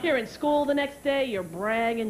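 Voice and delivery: ordinary delivery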